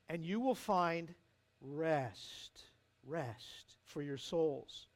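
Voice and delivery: hushed, gentle promise of rest; tender promise. Acted. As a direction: let the words settle like a lullaby. Jesus promising rest, slowing down.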